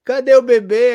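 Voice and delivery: put-on loud, high-pitched peekaboo to a baby; cartoon tiger. Imitating the Ice Age tiger with a crying child, loud playful peekaboo. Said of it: "que a criança fica chorando e o tigre".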